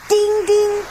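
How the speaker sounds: high sung ding like a little bell. A narrator's reading. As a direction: ring it out high and bright. Train bell sound in story.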